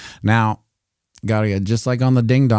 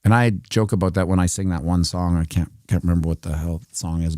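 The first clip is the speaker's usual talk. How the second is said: ordinary talk, nothing marked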